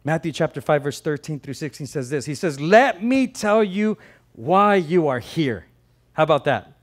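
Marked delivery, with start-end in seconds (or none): 2.6-5.7 slow, emphatic, slightly raised voice; slow proclamation. Acted. Jesus answering the disciples' question, slow and emphatic. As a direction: declare it word by word, slowly.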